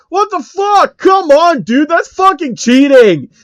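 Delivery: very high, loud indignant yell; furious gamer complaint; outraged. Acted. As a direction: scream it's unfair. Phil beaten, complains.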